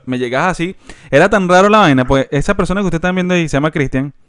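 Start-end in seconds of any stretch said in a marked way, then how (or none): none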